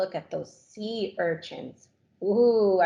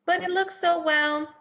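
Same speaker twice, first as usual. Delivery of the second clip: hesitant crab; 9 semitones higher than usual. Acted. Crab hesitant about plain shell.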